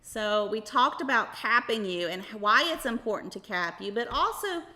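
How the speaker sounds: upbeat, sing-song host voice; kids show host. Acted. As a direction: talk brightly to a room of kids. Host addressing children throughout.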